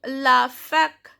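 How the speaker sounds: slow "la fac" with a hard k ending; "fac" ending on a clear k; pronounced final C. Shown as a demonstration. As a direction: say "la fac" slowly, sounding the C. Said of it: "here C is pronounced".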